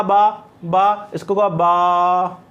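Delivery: final "बा" drawn out very long; short "बा" sounds, then one long held "बा"; lengthened last syllable. Shown as a demonstration. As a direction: stretch the vowel of the last "बा". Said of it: "इसकी length बढ़ा दी".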